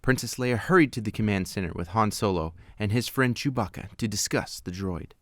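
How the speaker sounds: ordinary delivery, nothing marked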